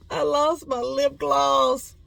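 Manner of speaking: slow, high, loud lament; mock sad wail. Telling viewers of lost lip gloss, mock sad wail. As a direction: draw it out in a playful whine. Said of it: "I'm sad, guys".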